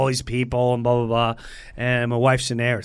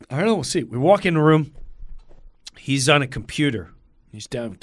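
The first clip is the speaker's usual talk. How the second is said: normal talking voice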